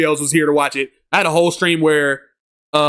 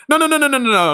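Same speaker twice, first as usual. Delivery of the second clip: quick denial; 8 semitones higher, faster than usual. Real, not acted. Denying viewer's wrong guess.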